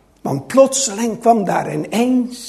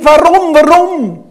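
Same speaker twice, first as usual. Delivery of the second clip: loud, higher anguished "why"; raised, ringing outcry; crying out; 5 semitones higher, 7 dB louder than usual. Acted. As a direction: cry it out in protest. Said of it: "dat de Heer Jezus riep".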